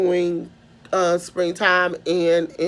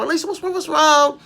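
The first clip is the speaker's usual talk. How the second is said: imitating concerned teacher; 7 semitones higher, faster than usual. Teacher alarmed at crying student.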